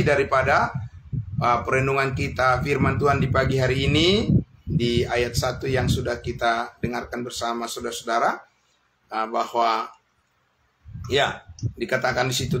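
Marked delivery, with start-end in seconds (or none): none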